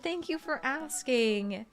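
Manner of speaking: ordinary delivery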